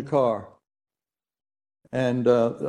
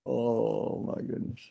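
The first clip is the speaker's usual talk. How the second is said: real tears, voice breaking to a whisper; overcome, barely getting the words out; choked up; 4 semitones lower, 8 dB quieter, slower than usual. Real, not acted. Preacher overcome with emotion.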